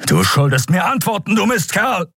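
high, strained angry cry; furious outburst. A narrator's reading. Admiral shaking his dying prisoner for answers, high furious cry. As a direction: let the anger climb to the last word.

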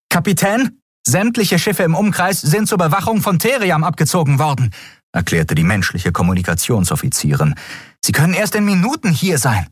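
officer reporting to her captain; lightly voiced communications officer. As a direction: report bad news to an angry captain.

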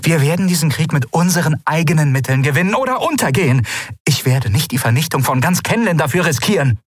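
high, fast, indignant tirade; angry ruler. A narrator's reading. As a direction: she scolds them in disbelief. Lien berates her council. (empört und schnell, viel höher)

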